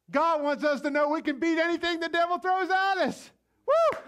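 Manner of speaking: high, loud, soaring climax with a whoop; excited preaching. Real, not acted. Preacher rousing congregation about beating the devil, high and loud. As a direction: lift the church up to the peak.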